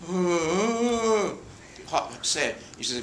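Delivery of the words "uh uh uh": high blank uh sounds; mock confused old star; vacant mumble. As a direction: answer with empty confusion. [name] with Alzheimer's.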